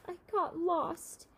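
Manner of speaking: put-on little boy sobbing his apology. A narrator's reading. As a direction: blubber it through tears. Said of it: "sobbed Tol".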